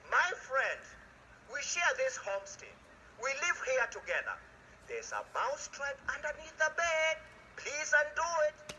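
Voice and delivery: high, pleading little mouse voice; a squeaky mouse asking nicely. Acted. Mouse begs the he-goat.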